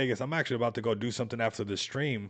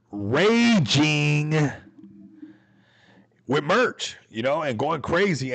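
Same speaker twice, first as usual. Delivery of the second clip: loud, hyped "raging"; stretched, shouted rallying cry; 3 semitones higher, 6 dB louder, much slower than usual. Real, not acted. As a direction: belt it out to rally the fans. Hyping community raging.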